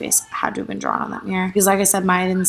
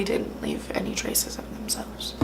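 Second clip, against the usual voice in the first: whisper; 10 dB quieter, slower than usual. Real, not acted. Fearful aside about stalker.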